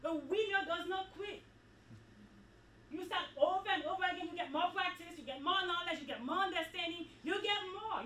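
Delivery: loud, rising sermon climax; preaching at full force. Acted. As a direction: preach it out, building with each line.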